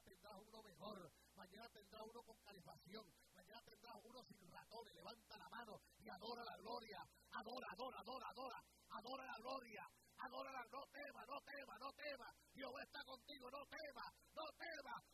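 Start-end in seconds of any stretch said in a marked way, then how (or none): 4.9-15.0 loud, driving exhortation, each phrase punched; fiery preaching. Acted. Preacher urging the congregation to adore, fiery exhortation. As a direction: rouse the crowd to worship.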